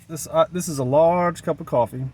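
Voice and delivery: usual speaking voice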